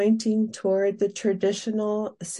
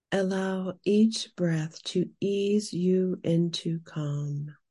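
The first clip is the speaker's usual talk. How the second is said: guided meditation. Acted. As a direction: guide the listener gently into calm. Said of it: "Now we will move into our guided meditation".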